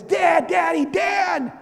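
put-on high, urgent child's call; waking dad up. Daughter waking dad at night, imitated high urgent call. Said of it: "she wakes me up like this".

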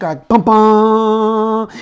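slow, high, loud sung bum bum stabs; dramatic music sting. Retold or imitated. Preacher imitating the caught-criminal movie music, slow hummed stabs. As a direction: hum the movie sting as the spotlight hits. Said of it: "and the music".

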